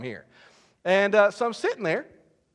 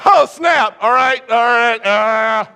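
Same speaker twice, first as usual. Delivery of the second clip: loud, high hammed-up alarm; comic panic; 7 semitones higher, 13 dB louder, much slower than usual. Student falling behind in class, comic panic. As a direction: shout like an overwhelmed student.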